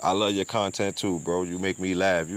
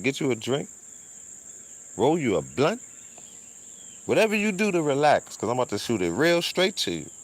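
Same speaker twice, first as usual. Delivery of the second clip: verbatim pastor quote; 6 semitones higher than usual. Retold or imitated. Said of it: "this is him verbatim, quote, unquote".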